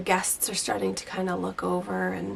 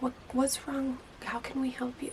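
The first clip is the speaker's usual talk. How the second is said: soft, higher, soothing question; gently calm the angry client down; 5 semitones higher, 7 dB quieter than usual. Retold or imitated. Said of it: "in such a calm voice".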